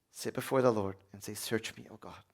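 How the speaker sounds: whisper-soft invitation to pray; prayer fading to nothing; hushed prayer. Shown as a demonstration. Quiet prayer demonstrated.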